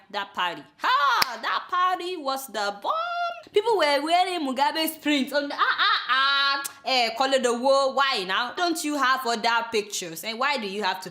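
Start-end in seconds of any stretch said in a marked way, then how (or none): 0.1-5.8 like Sudia. Retold or imitated. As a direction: drag out "bomb" the way Sudia would, a light send-up. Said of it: "let me say it like Sudia".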